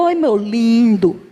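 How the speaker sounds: slow, drawn-out mock-sweet address; playful syrupy sweet talk to a husband; coaxing wife. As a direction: stretch out an exaggerated endearment. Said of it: "quando eu tô brava com ele, eu falo".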